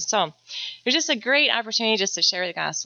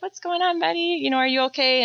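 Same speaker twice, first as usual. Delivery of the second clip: put-on higher, tender check-in with a little boy; gentle mom to son; 9 semitones higher than usual. Mom retells comforting her son.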